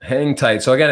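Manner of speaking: ordinary talk, nothing marked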